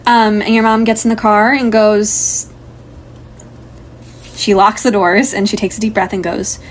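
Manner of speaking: ordinary talk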